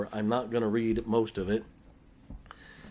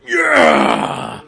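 loud deep shout of approval; whole room hollering yeah; mock congregation shout; chapel cheer; 8 semitones lower, 15 dB louder than usual. Chapel responds to prayer.

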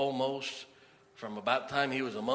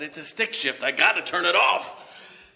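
raised voice building to loud and high; retold frantic protest; 6 semitones higher, 9 dB louder than usual. Driver to hospital staff pulling him out, exasperated retold shout. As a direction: act out a panicked objection, louder to the end.

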